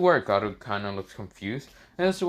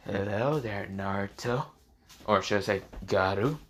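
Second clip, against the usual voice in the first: sinister snake voice; 3 semitones lower, 4 dB quieter than usual. Acted. Orochimaru voiced in told story.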